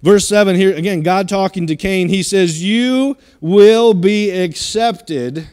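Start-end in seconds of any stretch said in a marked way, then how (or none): 2.6-5.5 slow solemn God voice. Acted. God speaking to Cain, verse seven.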